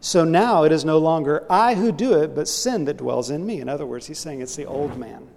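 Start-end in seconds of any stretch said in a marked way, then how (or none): 0.0-3.6 loud, forceful reading of the verse; emphatic scripture reading. Acted. Preacher reads Romans to his church, loud and emphatic. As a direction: proclaim Paul's words to the congregation.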